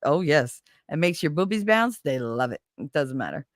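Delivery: usual speaking voice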